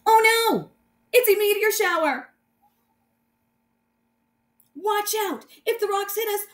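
high, loud panicked hero cry; alarmed shout. Acted. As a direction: sound the alarm, the rocks are coming. Meteor shower alarm in story.

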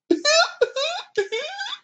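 very high, squealing mock laugh; dumb laugh. Mocking someone making fun of people, dumb high laugh. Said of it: "Dumb laugh".

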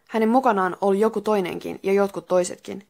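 ordinary delivery

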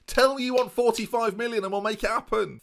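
high, loud negotiator's plea; pushy haggling. Pretend. Streamer haggling over a transfer fee, high and pushy. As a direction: press the offer hard and high.

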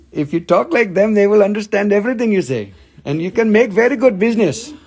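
high, loud mock Indian accent. Shown as a demonstration. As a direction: switch into an excited put-on Indian accent. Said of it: "I had to talk like them".

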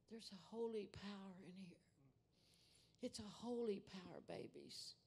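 soft, awed, near whisper; preacher hushed as the Spirit moves; hushed, reverent. Real, not acted. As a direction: call the pastor up softly, overcome. Holy Spirit moment, calling the pastor.

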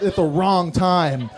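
normal talking voice

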